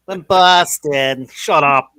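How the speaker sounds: mock Boston accent, stretched out; annoying Boston accent. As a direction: parody a Bostonian with slow vowels. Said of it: "the most annoying accent".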